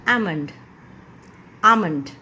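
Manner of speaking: आमंड with the L left out; silent L in almond. Shown as a demonstration. Teacher showing students that the L is silent, silent-L आमंड. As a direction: say आमंड as "aa-mund", no L. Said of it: "आपका pronunciation बनेगा".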